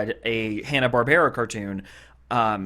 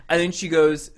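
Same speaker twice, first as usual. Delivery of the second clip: normal talking voice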